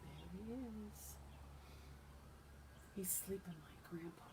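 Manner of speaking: soft whisper; quiet, whispered comment to the listeners; hushed aside. Real, not acted. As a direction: whisper it as if someone is asleep nearby. Aside while giant sleeps in story.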